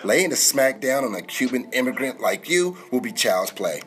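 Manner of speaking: ordinary delivery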